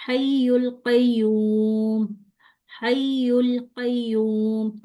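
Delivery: drawn-out tajweed chant; slow Quranic recitation. Shown as a demonstration. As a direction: recite each word slowly and clearly. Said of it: "तो फौरन उठा के मैं आगे चलेगी".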